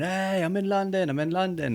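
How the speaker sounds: high mock yell, no real volume; imitated screaming. Comedian on a rickshaw filming himself in London, high mock scream. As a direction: act out a comedian yelling high. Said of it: "he was just screaming".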